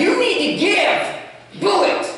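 high, slow mock order from Paul; hammed-up bossy decree; a drawn-out, lightly mocking command; mock stern command. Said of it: "Paul didn't come down and say".

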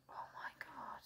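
very soft, voiceless whisper; awed whisper. Real, not acted. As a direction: whisper it under your breath in disbelief. Awed reaction to the lights.

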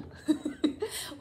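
airy breathy laugh; light laugh. Real, not acted. Joking with viewers about the baby, a light laugh. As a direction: laugh at your own joke.

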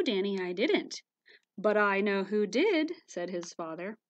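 lively picture-book read-aloud with voices; reading to children. A narrator's reading. Storyteller reading a valentine story to children, lively. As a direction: read the story to little ones.

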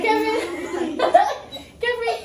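laughing, calling loudly; laughing shout. Real, not acted. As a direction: shout the name while laughing.

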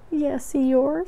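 small, high, sorry voice; Piglet, sniffling a little. A narrator's reading. Piglet admits it to Eeyore, sniffling a little. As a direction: answer high, with a touch of tearfulness. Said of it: "sniffling a little".

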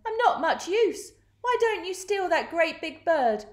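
high goose voice; hissing goose. A narrator's reading. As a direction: protest high-pitched as a goose. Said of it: "she hissed at them".

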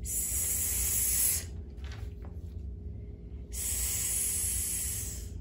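long hissed s; sonido s. Shown as a demonstration. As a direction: hiss a soft steady sss. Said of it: "repasemos nuestros sonidos". (sonido s siseado)

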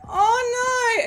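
high, loud oh no; alarmed cry. Real, not acted. Player realising the run may end, alarmed. About to die in game.

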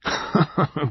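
short genial chuckle; genial laugh. A narrator's reading. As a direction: chuckle good-humouredly in character. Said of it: "He laughed genially".

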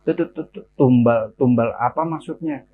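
stuttered, faltering start; stammering. Acted. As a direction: trip over the first syllables. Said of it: "Sambil terbata-bata dia menjawab".

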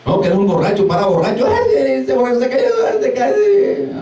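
high, taunting laugh-voice; mocking the drunk. Preacher imitates someone jeering at a fallen drunk, high mocking. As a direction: jeer at the drunk who fell.

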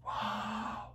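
breathless whispered wow; awed whisper. Retold or imitated. Speaker recalls hearing God speak, whispered awe. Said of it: "And I was like".

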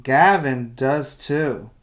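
ordinary delivery, nothing marked

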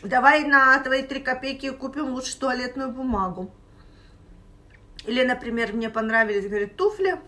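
chewing between words; eating while talking; mouth partly full. Real, not acted. Seen 0: Holds pizza slice; lips pressed, chewing motions between phrases, mouth partly full.

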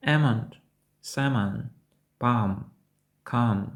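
"Almond, salmon, palm, calm" with every L dropped; silent L. Shown as a demonstration. Teacher reviews the word list, silent L. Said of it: "досить часто не вимовляємо L".

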